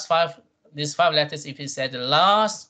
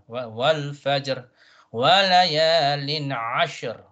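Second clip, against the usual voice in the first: slow, drawn-out verse reading; measured Quranic model reading; tajweed recitation; much slower than usual. Shown as a demonstration. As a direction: recite slowly, sounding both final consonants. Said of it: "the two sukuns must be pronounced".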